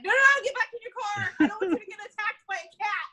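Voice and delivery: high, loud, fast warning shout; urgent yell. Retold or imitated. Warning bystanders away from the cat, a fast high shout. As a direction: yell them back into the car, quickly. Yelling at people leaving car.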